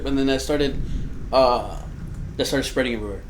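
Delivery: ordinary delivery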